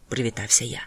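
plain reading voice

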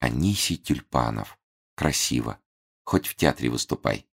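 soft, dreamy and lingering. A narrator's reading. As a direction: say his name like a daydream. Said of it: "мечтательно повторила".

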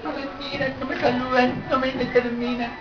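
very soft little self-pitying voice; mock hurt complaint. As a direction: mimic a wounded churchgoer, barely audible. Mimics an offended churchgoer complaining. (queja ofendida fingida, muy bajito)

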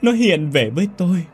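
mother wailing for her dead son to her husband; wailing lament. A narrator's reading. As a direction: cry out to the heavens for the lost son. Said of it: "Mụ vợ gào lên".

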